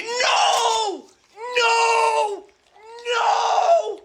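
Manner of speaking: high, loud repeated screams of no; screaming no. Acted. Outraged player at game table.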